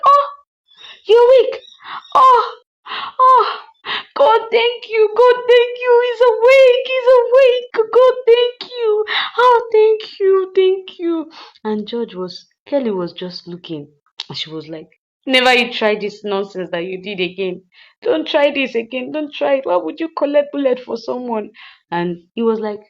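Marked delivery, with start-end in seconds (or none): 0.1-11.3 high, loud wailing cries of thanks; very excited. Acted. Woman at bedside thanking God he woke, very excited. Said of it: "Oma was very excited". 15.2-21.5 higher-pitched worried telling-off; mild scolding. Acted. Woman scolding the patient for taking a bullet, mildly cross.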